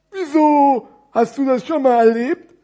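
high, loud puppet-like question; storybook character, strongly projected; curious big parcel. Acted. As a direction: ask with exaggerated wonder. Thick parcel asks.

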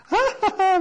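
hohes, helles Auslachen; spöttisch hell kichern; silberhelles Lachen. Acted. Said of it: "lachte silberhell die anderen aus". (silberhell auslachendes Lametta)

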